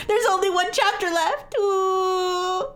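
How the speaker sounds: high mock-mournful ooh; mock sad whining. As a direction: fake lament, half laughing. Mock grief at story ending.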